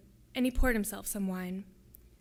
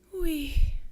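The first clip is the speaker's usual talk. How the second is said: higher, falling sigh of defeat; sad, defeated; 6 semitones higher, 7 dB louder than usual. A narrator's reading. Louisa admitting she is American, sadly defeated. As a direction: admit it with resigned sadness. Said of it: "With the sadness of defeat in her voice".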